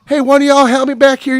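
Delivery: high, loud call for help; imitated high-voiced lady calling staff; woman customer.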